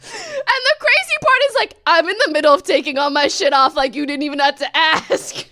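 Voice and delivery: high laughing rant; giggling while telling it. Real, not acted. Laughing retelling.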